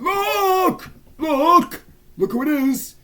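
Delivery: very high, loud squealing; excited squeal. Retold or imitated. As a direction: shriek it to your bestie like a fan. Retells shouting at bestie on FaceTime.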